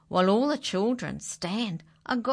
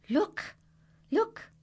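much higher, rising call; excited look; 14 semitones higher than usual. A narrator's reading. Narrator excitedly points out the kitten to the children. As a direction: point it out with delight.

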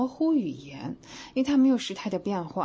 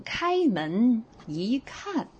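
slow demo read; 4 semitones higher, much slower than usual. Shown as a demonstration. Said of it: "再换成".

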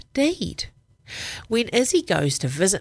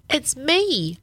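high, bright calling voice; 9 semitones higher than usual. Acted. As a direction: call out into the house. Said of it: "Izzy calls".

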